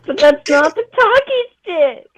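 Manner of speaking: huffy, pretend-offended correction; mock indignation. Indignant protest, higher and louder.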